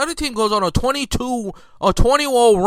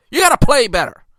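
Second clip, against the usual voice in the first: ordinary delivery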